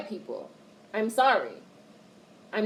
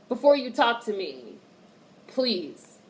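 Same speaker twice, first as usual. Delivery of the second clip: normal talking voice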